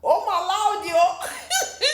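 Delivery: loud, high cry of amazement; soaring, amused disbelief; shocked exclamation. Real, not acted. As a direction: exclaim at juicy gossip, voice leaping up. Reacting to the quoted accusation.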